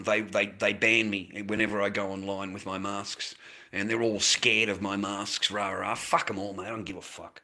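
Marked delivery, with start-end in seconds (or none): none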